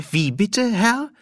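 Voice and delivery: high, confused query; ask, baffled; puzzled shopkeeper. Acted. (verwirrte, hohe Frage)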